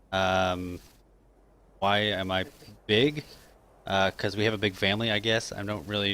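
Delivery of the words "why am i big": ordinary talk, nothing marked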